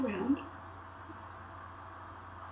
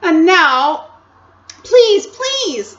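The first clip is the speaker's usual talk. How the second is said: put-on much higher, louder boyish begging; Edmund pleading; 10 semitones higher, 12 dB louder, much slower than usual. Edmund begging the Queen for sweets, whining. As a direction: whine like a greedy boy.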